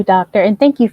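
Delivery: ordinary talk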